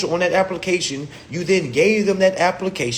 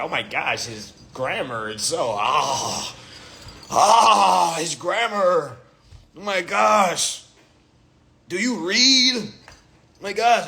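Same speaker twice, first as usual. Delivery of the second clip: hint of a prissy, shocked voice; lightly mock the grammar police; grammar snobs; slower than usual. Critics sniffing at his grammar, lightly prissy shocked voice. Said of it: "They be like".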